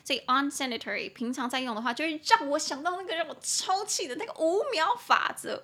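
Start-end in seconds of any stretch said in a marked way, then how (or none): 1.9-5.6 high-pitched playful indignation; exasperated. Real, not acted. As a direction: wound up just thinking of it.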